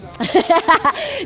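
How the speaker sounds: light laugh. Real, not acted. Laughing during jump rope.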